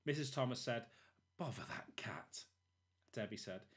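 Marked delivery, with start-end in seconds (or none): 1.4-2.5 hushed annoyed whisper; whispered grumble. Acted. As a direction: hiss it under the breath. Mrs Thomas annoyed at cat.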